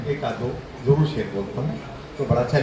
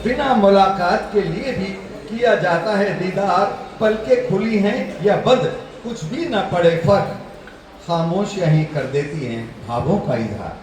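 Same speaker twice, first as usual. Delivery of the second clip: higher, lilting verse recitation; shayari recital; 6 semitones higher than usual. Real, not acted. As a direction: declaim the couplet to a mushaira audience.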